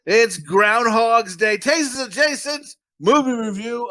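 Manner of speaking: slow, drawn-out announcer read; show title announcement. Acted. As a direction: stretch the title out like a show opener. Podcast episode title announcement.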